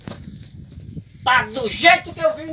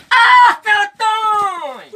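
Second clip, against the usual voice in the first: long, drawn-out raised shout; excited calling out; 4 semitones higher, 4 dB louder, much slower than usual. Acted. Treasure hunter calls his companion over the find, shouting excitedly. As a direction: call the name out slowly, higher. Calls out on finding buried signs.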